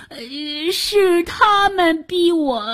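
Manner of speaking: high, slow, trembling plea; 哆哆嗦嗦. A narrator's reading. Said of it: "哆哆嗦嗦地跪在地上说". (吓得哆嗦、高声拖长地求饶)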